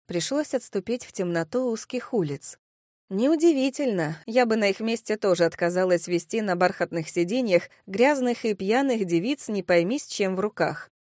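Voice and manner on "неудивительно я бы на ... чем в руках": plain reading voice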